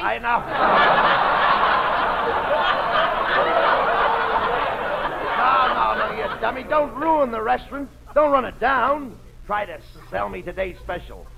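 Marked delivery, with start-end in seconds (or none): 0.5-5.4 high, loud unvoiced cackle of laughter; big burst of laughter. Real, not acted. Man laughs at his partner's gag. 5.7-9.2 scolding. Acted. Man scolds his waiter partner.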